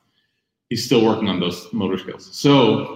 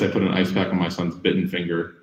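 normal talking voice

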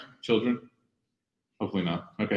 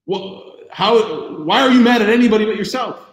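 exasperated dad; 5 semitones higher, 3 dB louder than usual. Retold or imitated. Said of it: "that's what I'm thinking".

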